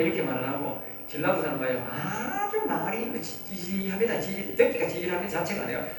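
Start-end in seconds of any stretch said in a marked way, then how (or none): 1.9-4.6 slow, high, dragged-out word; a lightly mocking slow drawl; drawn-out mocking. Shown as a demonstration. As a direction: drag the word out higher, mockingly. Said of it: "말도 지지리하게 합니다".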